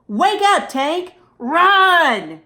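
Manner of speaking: high, loud wake-up call; urgent shout. Acted. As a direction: shout to rouse the sleeping hamster. Urgently rousing the hamster.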